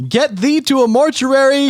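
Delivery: high mock Shakespearean pronouncement; old stage voice. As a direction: declaim it like an old stage actor. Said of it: "It's like".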